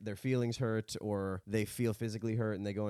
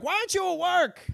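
much higher, louder accusing aunt voice; shrill aunt; 6 dB louder than usual. Pretend. The aunt scolding her nephew for not being at work, very high and loud. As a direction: play the nagging aunt. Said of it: "I'll do the aunt".

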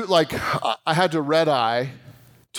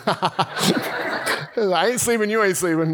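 slow, laughing, higher mock taunt; laughing tease; 5 semitones higher, slower than usual. Teasing a sleepy plane seatmate, laughing.

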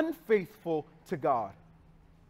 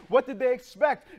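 normal talking voice

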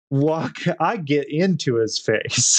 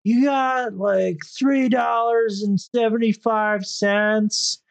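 higher wheedling mock voice; imitating the stranger; 6 semitones higher than usual. S0 mimics the guy asking for cigarette money, higher mock voice. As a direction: beg for change in a pitched-up put-on voice.

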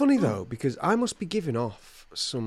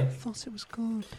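high, soft mimicked punter; quiet, high-pitched imitation of faint praise; audience member; 10 semitones higher, 8 dB quieter than usual. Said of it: "and they go".

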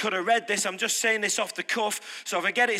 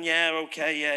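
casual; 5 semitones lower than usual. Retold or imitated. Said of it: "all casual and".

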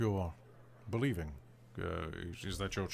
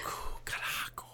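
airy ASMR whisper; elven ASMR whisper; 7 dB quieter than usual. Pretend. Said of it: "Elven A S M R".

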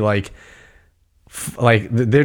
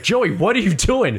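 a touch of New York, said higher; mild New York accent, higher; 8 semitones higher than usual. Retold or imitated. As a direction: let a little New York slip into Joey, what are you doing?. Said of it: "Your New York came out a little bit".

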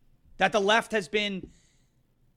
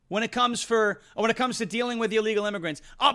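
usual speaking voice

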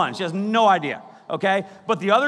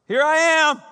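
higher, louder Here I am; bold announcement; 5 semitones higher, 4 dB louder than usual. Shown as a demonstration. Jesus boldly announcing himself at the door. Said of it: "It's more like this".

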